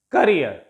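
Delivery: "career" said as kuh-REER; short first syllable, long end in "career"; stress on last syllable. Shown as a demonstration. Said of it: "stress will take place on the last syllable".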